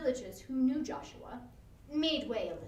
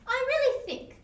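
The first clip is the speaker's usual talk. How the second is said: higher, louder doctor's voice; voiced doctor; 11 semitones higher, 8 dB louder than usual. A narrator's reading. Doctor urging his friend, raised and eager. Doctor's quoted speech in story.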